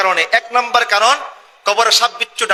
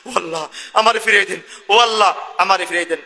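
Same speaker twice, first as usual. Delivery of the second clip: ordinary delivery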